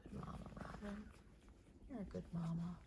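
soft, low, whispery praise; hushed tenderness. Real, not acted. Owner praising the mama dog by her puppies, hushed. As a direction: murmur gently to the mother.

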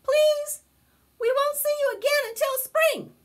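very high, loud critter plea; squeaky little friends begging; pleading friends. Acted. Friends plead with Bear before winter, high and loud.

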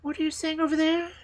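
put-on very high, quick little-girl call; high-pitched eager question; crying out. A narrator's reading. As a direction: call out urgently as little Gerda. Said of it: "cried Gerda".

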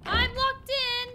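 high, loud, drawn-out cry; alarmed shout. Real, not acted. Player finds himself locked in, alarmed shout. Startled at being locked in game.

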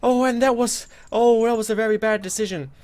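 loud, high frightened wail; frightened yelp. Real, not acted. Gamer startled by a monster, frightened loud wail. Monster appears behind him.

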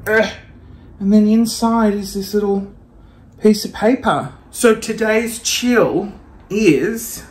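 normal talking voice

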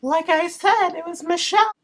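put-on high girly voice. Prank caller's high voice.

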